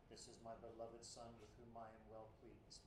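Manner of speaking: put-on quiet, detached monotone as heard in childhood; flat, distant reading. Preacher recalls childhood reading of God's words, quiet and flat. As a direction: read it cold and far away, no joy. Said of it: "in the voice of my childhood again".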